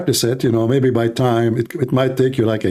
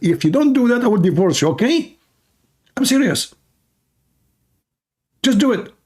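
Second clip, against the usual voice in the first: much higher, pushy mock wife voice; wife threatening divorce, voiced much higher; 10 semitones higher than usual. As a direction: squeak the ultimatum like a fed-up wife.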